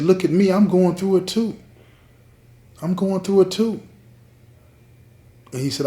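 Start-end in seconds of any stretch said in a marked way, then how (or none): 0.0-3.8 higher, louder, pleading; emotional plea. Retold or imitated. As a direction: cry out that she's hurting too. Emotional plea to her lover.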